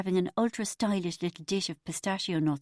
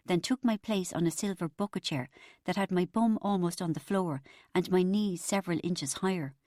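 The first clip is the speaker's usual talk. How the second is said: ordinary delivery, nothing marked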